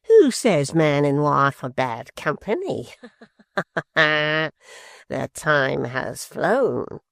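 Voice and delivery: slow, with a chuckle, mocking; gloating. A narrator's reading. Quilp mocking his mother-in-law, slow gloating chuckle. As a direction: laugh at her through it. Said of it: "with a smile of which a frown".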